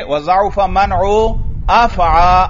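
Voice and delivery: अफ़आ stressed, ending in open long aa; recited phrase, emphatic open-ended अफ़आ; gair munsarif reading. Shown as a demonstration. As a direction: land loudly on अफ़आ, no final n. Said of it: "फिर किस तरह पढ़ेंगे?".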